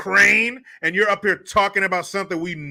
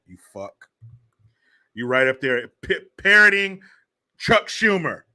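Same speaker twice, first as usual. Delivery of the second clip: ordinary talk, nothing marked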